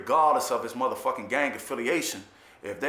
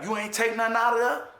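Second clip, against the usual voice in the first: pitched-up incredulous question; surprised neighbor; 9 semitones higher than usual. Retold or imitated. The neighbor amazed nothing was taken, a high disbelieving question. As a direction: ask it in disbelief, voice jumping high. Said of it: "And he'd be like".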